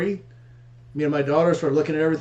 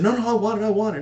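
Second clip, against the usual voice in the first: retold eager insistence, pitched higher; mock eager; 6 semitones higher, faster than usual. Buyer stopping seller from taking the bike back, mock eager. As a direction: imitate a keen buyer, voice raised in pitch. Buyer hastily grabbing the deal.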